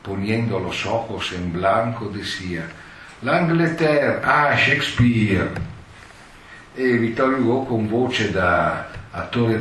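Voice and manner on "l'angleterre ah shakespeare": slow, high, loud theatrical sigh of awe; eyes rolled up, declaim like an old-school tragedian; dramatic tremolo actor. Retold or imitated. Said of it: "con voz de drammatico tremulo". (attore drammatico, lento e tremante)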